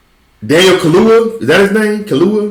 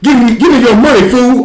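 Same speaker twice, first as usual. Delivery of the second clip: higher, louder mock-tough robbery line; tough guy; 10 semitones higher, 3 dB louder than usual. Actor auditioning for the director, a hammed-up thug voice. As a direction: bark it like a street thug. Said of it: "I'll give it a go".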